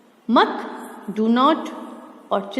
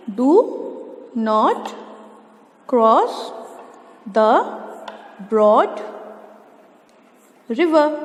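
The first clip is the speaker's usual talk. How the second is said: word-by-word dictation; much slower than usual. Shown as a demonstration. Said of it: "dictating word by word".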